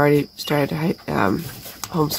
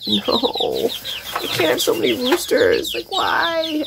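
exasperated; 9 semitones higher than usual. Retold or imitated. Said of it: "which I'm like".

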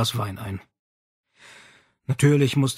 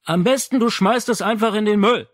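higher, louder for the father to hear; said loudly; 9 semitones higher, 5 dB louder than usual. Acted. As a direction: say it loud for downstairs. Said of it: "sagte Rizzi laut".

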